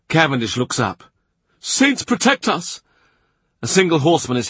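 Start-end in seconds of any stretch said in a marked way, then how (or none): 1.6-2.8 high, drawn-out exclamation of alarm; high-pitched dread at what's coming; alarmed cry. A narrator's reading. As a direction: cry out slowly for heaven's protection. Cavendish spots a galloping horseman.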